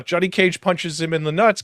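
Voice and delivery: normal talking voice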